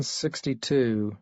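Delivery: usual speaking voice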